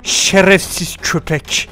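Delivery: slow low menacing insult; slow low mock-villain growl. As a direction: drag out the insult like a movie villain.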